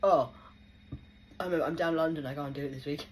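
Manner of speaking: loud startled brush-off; hammed-up politician backing out; mock excuse. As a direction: play the dodging official. Mimicking MP's sudden excuse.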